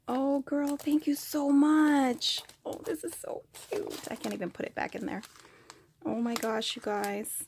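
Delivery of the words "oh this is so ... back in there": soft whispered gush; delighted whisper. Real, not acted. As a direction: whisper it, charmed. Whispers over a cute gift.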